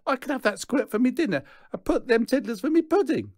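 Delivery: high, loud, fast scoff; a mocking brag, high and loud. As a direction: sneer it out fast. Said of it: "scoffs Ted".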